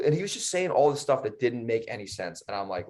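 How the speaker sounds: ordinary talk, nothing marked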